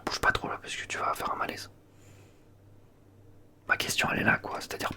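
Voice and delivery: usual speaking voice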